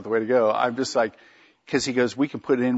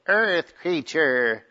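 slow, higher alien creature voice; 5 semitones higher, much slower than usual. Shown as a demonstration. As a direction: drag it out like a space alien. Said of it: "so I went into my alien voice".

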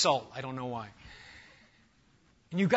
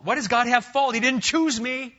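indignant objector; 8 semitones higher, 6 dB louder than usual. Acted. Voicing the objector's complaint.

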